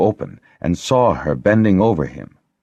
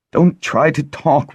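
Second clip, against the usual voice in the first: higher, tearful plea; holding back sobs; 9 semitones higher, 4 dB louder than usual. A narrator's reading. Lessa begging the Harper, higher and tearful. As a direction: choke the words out through tears. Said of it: "gulping back her sobs".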